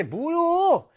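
high, drawn-out annoyed whine; mock irritation. Shown as a demonstration. Teacher demos Chungcheong dialect for annoyance, mock irritated. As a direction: stretch it out as if fed up. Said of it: "짜증날 때".

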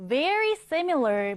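very slow and clear; slow model reading. Shown as a demonstration. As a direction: model the phrase for learners. Models phrase slowly. (아주 천천히 또박또박)

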